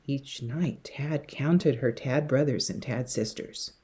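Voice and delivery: usual speaking voice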